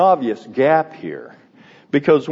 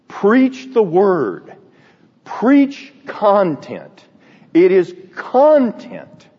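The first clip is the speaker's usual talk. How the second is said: slow, high, firm command; emphatic teacher; 6 semitones higher, 3 dB louder, slower than usual. Retold or imitated. Professor urging students to preach content, emphatic and slow. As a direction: drive each word home slowly. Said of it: "he would say".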